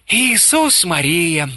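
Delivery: high, slow, drawn-out lament; sighing dismay. A narrator's reading. Corporal watches his rum disappear, sighing in dismay. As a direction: sigh it out slowly at a painful loss. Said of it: "вздохнул капрал".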